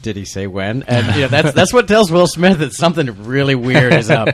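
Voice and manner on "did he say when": deadpan, softer question with no excitement; stone cold. Retold or imitated. Fan quoting a film line to co-hosts, stone cold. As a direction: imitate an unfazed, stone-cold delivery. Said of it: "completely stone cold and says".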